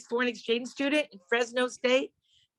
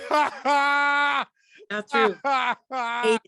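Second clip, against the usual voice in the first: laughing shout; 5 semitones higher, 5 dB louder, much slower than usual. Real, not acted.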